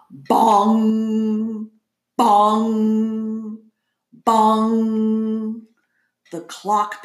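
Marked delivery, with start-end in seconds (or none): 0.1-1.7 drawn-out ringing bong; clock bong. A narrator's reading. Clock strikes midnight. 2.2-3.6 drawn-out ringing bong; clock bong. A narrator's reading. Clock strikes midnight. 4.1-5.6 drawn-out ringing bong; clock bong. A narrator's reading. Clock strikes midnight.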